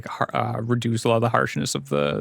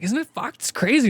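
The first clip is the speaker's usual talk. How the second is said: much higher, excited voice for Yuki; Yuki, amazed; 12 semitones higher than usual. Acted. Yuki chatting with Ivy over the pipe, giddy high voice. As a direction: pitch it way up like a giddy friend. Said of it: "he's like".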